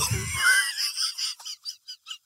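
high, quiet wheezing laugh; helpless laughter. Real, not acted. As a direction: dissolve into a breathless giggling fit. Breaks down while reading the story.

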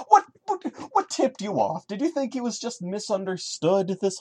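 exaggerated high mock surprise; sarcastic disbelief. Real, not acted. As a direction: feign shock at the obvious. Sarcastic reaction.

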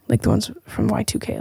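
ordinary talk, nothing marked

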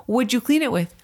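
mock argument. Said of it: "I'm like".

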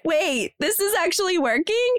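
high, amazed realisation; delighted surprise. Retold or imitated. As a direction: marvel that it's working.

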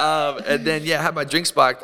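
usual speaking voice

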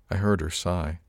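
plain reading voice

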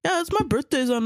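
put-on high smug kid voice; mocking kid. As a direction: sound like a bragging middle-schooler. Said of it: "They were always like".

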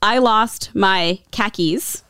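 "khakis" said slowly and evenly, own voice; slow setup. Shown as a demonstration. Host introducing the Boston sound-alike, slow "khakis". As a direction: lay out "I lost my khakis" slowly. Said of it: "the last one is another Boston one".

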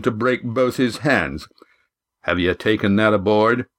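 tough host laying down house rules; gruff innkeeper, welcoming then warning. Acted. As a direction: play the burly innkeeper with a veiled threat. Innkeeper welcomes and warns guests.

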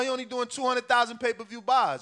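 ordinary delivery

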